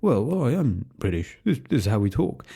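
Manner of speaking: hammed-up posh British bumbling; exaggerated British. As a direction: play up a stammering British accent. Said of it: "Hugh Grant's clearly said".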